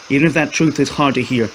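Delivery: ordinary delivery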